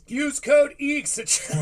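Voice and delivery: high, loud promo-code blast; shouted ad plug. Acted. Host yelling the sponsor code at listeners, loud hype. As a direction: yell the checkout code like a hyped ad.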